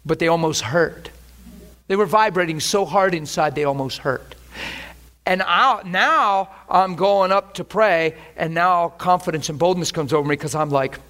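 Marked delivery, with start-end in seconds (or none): none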